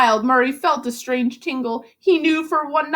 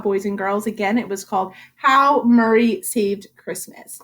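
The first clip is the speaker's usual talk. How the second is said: ordinary delivery, nothing marked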